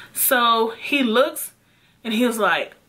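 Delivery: usual speaking voice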